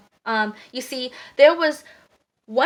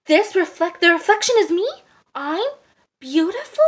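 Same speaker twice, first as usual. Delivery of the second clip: higher, halting, questioning wonder; amazed disbelief; 5 semitones higher, 5 dB louder than usual. A narrator's reading. Duckling realises it is his reflection.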